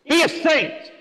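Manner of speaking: high, ringing Be a saint; emphatic dedication. Retold or imitated. Priest reading his book dedication aloud, high and emphatic. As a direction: declare it high like a blessing. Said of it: "And I'll say".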